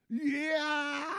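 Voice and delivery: wild high yell; battle cry. Acted. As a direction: shriek it out with a laugh. Attack cry in fight.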